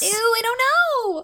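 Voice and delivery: high, slow grossed-out whine; disgusted squeal. Real, not acted. Disgust at the story.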